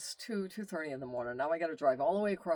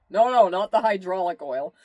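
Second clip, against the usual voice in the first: raised, urgent voice calling out a correction; retold loud correction; 4 semitones higher, 12 dB louder than usual. Husband calling to his wife across the shop, raised and urgent. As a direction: stop her fast, loud and insistent.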